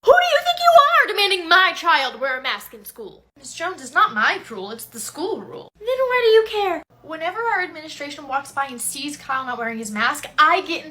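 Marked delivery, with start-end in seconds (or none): 0.0-3.2 angry yell. Acted. Angry parent attacks teacher. 5.8-6.8 angry retort. Acted. Angry parent attacks teacher.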